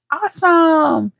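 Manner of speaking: high, slow, delighted awesome; drawn-out awesome. Real, not acted. Auntie praising Josh's success, slow and delighted. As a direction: stretch it out in delight.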